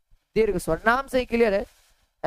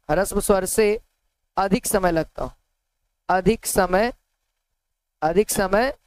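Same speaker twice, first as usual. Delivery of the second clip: slow dictation. Shown as a demonstration. Teacher dictating the definition of ह्रस्व स्वर, slow. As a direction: dictate each word with long gaps, slowly. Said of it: "teacher dictating a definition".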